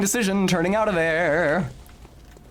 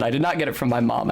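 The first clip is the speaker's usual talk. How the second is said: ordinary talk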